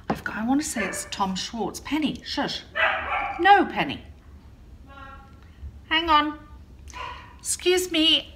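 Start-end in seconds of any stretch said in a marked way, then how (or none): 1.8-6.4 higher, louder scolding call to the dog; stern, raised shush at the dog. Real, not acted. Dog keeps barking during recording. 7.4-8.3 higher, louder call to someone in another room; raised call toward the daughter. Real, not acted. Daughter calls from elsewhere.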